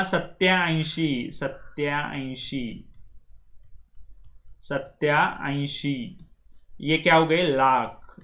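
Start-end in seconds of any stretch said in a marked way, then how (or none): 0.0-6.3 सत्याऐंशी stretched out very slowly, long आ in the middle; Marathi number. Shown as a demonstration. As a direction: drag out सत्याऐंशी for students to copy. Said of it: "कितना?".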